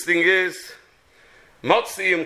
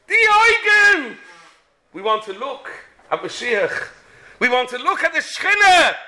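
loud, high impassioned sermon; fiery preaching; 7 semitones higher, 4 dB louder than usual. Acted. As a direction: preach with rising fervour. Preaching at full force.

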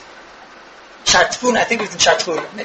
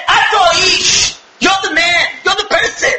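prophet's rebuke; 7 semitones higher than usual. Acted. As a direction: thunder the accusation at him. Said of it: "And Natan said".

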